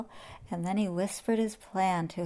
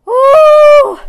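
very high, loud, sustained "ooh"; pained howl; 23 dB louder than usual. A narrator's reading. Lion trampled in his bed, howled. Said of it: "howled the lion".

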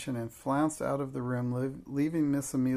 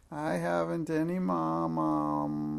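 put-on slow, drawn-out childish wail; higher-pitched howl, stretching out the last word; half-hearted howl; 4 semitones higher, slower than usual. A narrator's reading. Small child resuming her tantrum, half-hearted wail. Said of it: "made her first howl rather half-hearted".

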